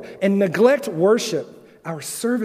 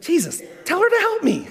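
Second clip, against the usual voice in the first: much higher, whiny plea for help; flustered Martha; 12 semitones higher than usual. Retold or imitated. Martha complaining to Jesus about her sister, high whine. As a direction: mimic a frazzled hostess complaining. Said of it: "Martha literally goes up to Jesus and says".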